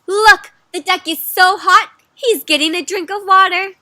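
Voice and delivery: high, loud storybook excitement; excited pointing out. Acted. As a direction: call attention to your discovery. Duck believer points excitedly.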